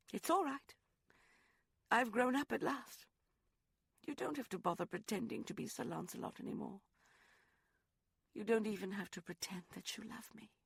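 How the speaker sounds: brave it out, then let it drop; Rosalind, bitterly resigned. Acted. Woman bitterly releases her lover from pretending, high.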